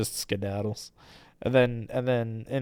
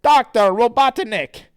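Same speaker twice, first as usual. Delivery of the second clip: high, loud theatrical villain; [name]; 11 semitones higher, 7 dB louder than usual. Acted. Villain introduces his father and associate, grandiose. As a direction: grandly present like a cartoon villain. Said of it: "And then [name] says".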